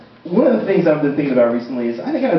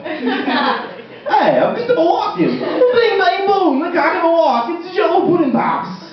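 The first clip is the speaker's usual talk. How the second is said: much higher, laughing hack impression of a famous actor; bad Christopher Walken impression; 13 semitones higher, slower than usual. Comic mocks a bad impressionist doing a famous actor, much higher and laughing. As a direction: ham up a clumsy celebrity imitation. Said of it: "my impression of a guy doing a bad impression of Christopher Walken".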